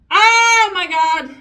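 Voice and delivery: loud, drawn-out oh my God; shocked exclamation. Real, not acted. Vlogger reacting on camera to her makeup, shocked and loud. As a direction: blurt it out in real shock.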